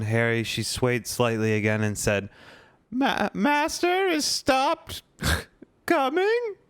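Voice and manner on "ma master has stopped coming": very high, slow, faltering elf squeak; a tipsy elf stunned by the news; high tremulous house-elf, slow and halting; shaken Winky. Acted. Drunk Winky hears news.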